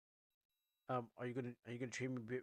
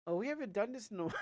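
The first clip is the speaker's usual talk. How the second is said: higher and louder, fast, breaking into a laugh; giggly first take of the line; laughing line read; 8 semitones higher, 5 dB louder, faster than usual. Shown as a demonstration. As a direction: rush it out with a giggle. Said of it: "retake of the same line".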